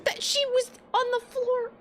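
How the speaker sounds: real excited surprise. Real, not acted. Player amazed the game drew the fainted girl. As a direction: gush over the surprise.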